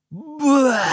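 high, loud gagging cry of revulsion; disgusted "bleurgh". Acted. Child sniffing the stinking cookies, disgusted groan. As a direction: recoil from a stink with a long yuck.

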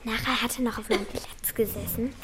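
whispered, a little scared; hushed dread about a creepy thought; whispering fearfully to a friend; frightened whisper. Acted. Scared kid reacts to news.

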